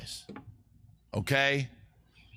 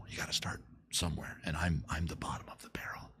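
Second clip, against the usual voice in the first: whispered aside; 9 semitones lower, 11 dB quieter, faster than usual. Real, not acted. Self-deprecating sponsorship aside.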